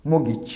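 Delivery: মগিজ with no t, "mo-gich"; corrected pronunciation. Shown as a demonstration. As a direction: say মগিজ with a silent t and short "gi". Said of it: "এর উচ্চারণটা আমরা কী বলবো?". (সঠিক মগিজ, "ট" ছাড়া)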